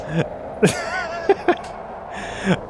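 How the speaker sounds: breathless unvoiced giggling; can't stop laughing at the wobbling camera; helpless laugh. Real, not acted. Streamer laughs helplessly at the nauseating head movement. Head-cam motion makes him queasy.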